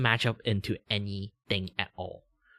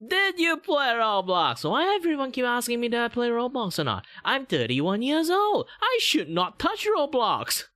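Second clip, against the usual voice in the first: heightened VTuber voice; 5 dB louder than usual. Acted. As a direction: push the pitch way up into the anime persona.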